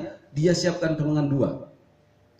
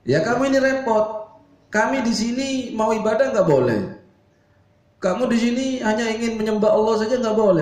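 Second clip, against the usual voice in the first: high, loud nagging mother's scold; scolding woman; 8 semitones higher, 3 dB louder than usual. Acted. Mother scolding Umar for blocking her, high shrill nag. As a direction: rant at him like an angry mum. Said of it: "dia malah marah-marah ya, emak-emak ngomel".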